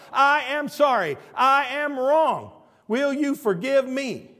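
high, loud, emphatic word by word; shouted apology. Shown as a demonstration. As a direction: proclaim the apology slowly at full volume. Repeats the words at full force.

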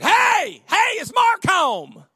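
high, loud call to the house; hollering. Retold or imitated. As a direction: yell through the open window. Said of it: "you just hollered".

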